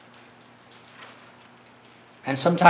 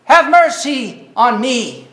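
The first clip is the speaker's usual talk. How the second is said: slow, raised plea that carries; calling out; 5 semitones higher, 3 dB louder, slower than usual. Retold or imitated. Blind beggar begging Jesus for mercy, calling out. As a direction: call out for mercy, higher and drawn out. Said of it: "That's calling".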